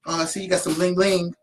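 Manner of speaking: lightly voiced needling teacher; teasing teacher.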